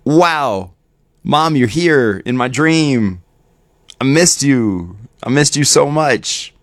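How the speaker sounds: joyful reunion. Pretend. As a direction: greet her with wonder and longing. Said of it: "Instead of being like".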